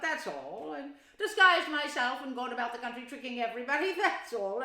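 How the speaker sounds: loud, smug listing with a laugh; boastful mock modesty. A narrator's reading. Toad boasting to Mole and Rat, smug. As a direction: brag while pretending not to. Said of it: "puffing and swelling already".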